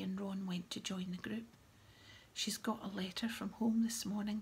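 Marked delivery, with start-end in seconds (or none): none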